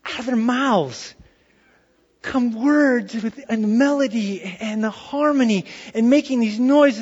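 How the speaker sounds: normal talking voice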